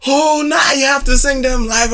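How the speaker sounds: high-pitched imitation of pushy people; mock crowd demand. Singer imitating people who push him to sing live, mock insistent. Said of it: "all these people are like".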